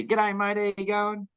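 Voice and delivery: high, sing-out Aussie hail; imitated matey greeting. Man at his tent greeting a passing Aussie, high and matey. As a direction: send the greeting out high, like hailing a passer-by.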